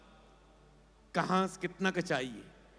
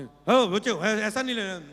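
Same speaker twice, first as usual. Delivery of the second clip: father scolding; 5 semitones higher, 6 dB louder than usual. Retold or imitated. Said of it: "थोड़ा डांट के रखा".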